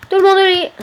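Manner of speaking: high, loud call to everyone. Pretend. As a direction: holler it like a bedtime order. Calling villagers to bed at nightfall. (appel lancé d'une voix forte)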